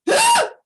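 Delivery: sudden high hiccup gasp; loud hiccup. A narrator's reading. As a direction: hiccup loudly and sharply. Santa hiccups.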